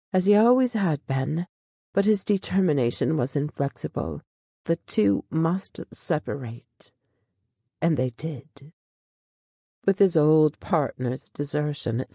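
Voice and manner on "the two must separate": ordinary delivery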